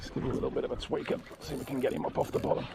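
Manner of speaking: soft, fast, near-whispered; under his breath. Real, not acted. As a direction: mutter it to yourself. Fighting fish quietly.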